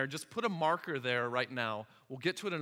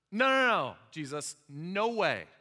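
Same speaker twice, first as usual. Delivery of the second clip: emphatic protest; 2 semitones higher, 5 dB louder than usual. Acted. Peter protests Jesus' prediction, loudly refusing it. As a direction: cut in with an insistent denial.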